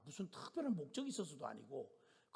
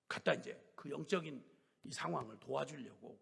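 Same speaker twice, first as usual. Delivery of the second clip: ordinary talk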